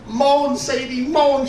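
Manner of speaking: high, urgent command; worked-up climax. Acted. Husband to wife at the crucial moment, frantic urging. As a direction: urge her on in a high voice. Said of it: "they're huffing and puffing".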